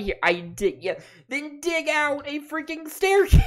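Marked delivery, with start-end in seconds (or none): 1.3-3.4 much higher, exasperated outburst at a friend; incredulous, pitched-up exasperation. Real, not acted. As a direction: throw up your hands and demand it. Exasperated at friend's missing stairs.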